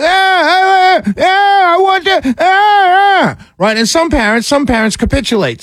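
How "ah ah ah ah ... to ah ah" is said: put-on high wailing toddler cry; whining child. Mocking crying kids as the host wails. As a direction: wail like a tantruming little kid. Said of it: "And they're like".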